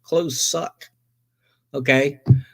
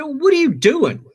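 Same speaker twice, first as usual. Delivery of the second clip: incredulous shout; 13 semitones higher than usual. Retold or imitated. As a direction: demand an answer, pitched way up. Confronting his frightened wife.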